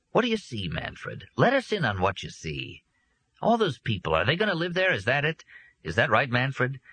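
lightly voiced coaxing grown-up; high coaxing voice. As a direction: coax the silent boy gently upward. Adult coaxing a silent boy.

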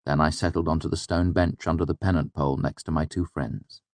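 usual speaking voice

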